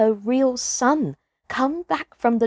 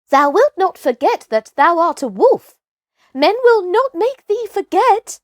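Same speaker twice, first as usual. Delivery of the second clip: higher, louder, anxious pleading wolf; 9 semitones higher, 6 dB louder than usual. A narrator's reading. Wolf brother anxiously pleading with Mowgli not to forget. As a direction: plead urgently, worried he'll be lost. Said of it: "said Grey Brother anxiously".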